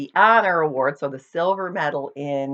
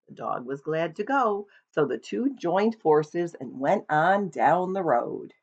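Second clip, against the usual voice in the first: ordinary delivery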